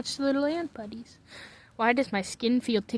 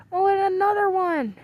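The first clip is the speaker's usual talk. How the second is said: high, louder delighted exclamation; excited squeal of discovery; 9 semitones higher, 5 dB louder than usual. Real, not acted. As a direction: exclaim a thrilled new find. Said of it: "get excited over ants".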